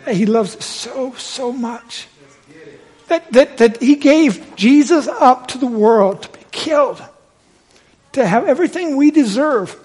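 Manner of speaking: high, loud, heartfelt preacher's plea; impassioned preaching. Real, not acted. Preacher telling how God gave Jesus up, impassioned preaching. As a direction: drive it home with rising fervor.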